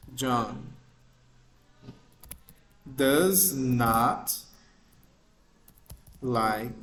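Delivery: slow, word-by-word English reading; slow dictation. Shown as a demonstration. Teacher dictating an example sentence slowly to students. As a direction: read each word out slowly for the class. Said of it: "O João não gosta de você".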